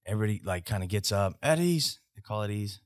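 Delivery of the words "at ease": barked command. Retold or imitated. Soldier barks military command.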